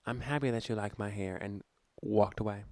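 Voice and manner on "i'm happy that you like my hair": soft, low imitation of a kindly refusal; loving letdown. Friend lets a girl down about her hairdresser, lovingly. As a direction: soothe while gently saying no. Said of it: "and so lovingly said".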